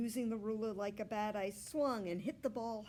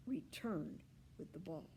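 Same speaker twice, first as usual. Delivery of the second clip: plain reading voice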